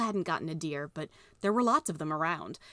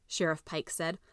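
ordinary delivery, nothing marked

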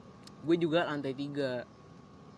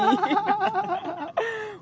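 loud high cackle; cracking up at the memory; high burst of laughter; 6 dB louder than usual. Real, not acted.

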